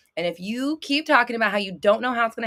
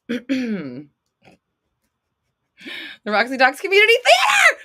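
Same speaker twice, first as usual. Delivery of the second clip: loud, high showman's shout; loud high hammed-up intro; excited announcement; 7 semitones higher, 8 dB louder, slower than usual. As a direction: announce it like a theater marquee. Announcing a show title.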